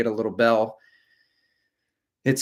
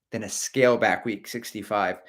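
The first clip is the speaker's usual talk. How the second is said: ordinary delivery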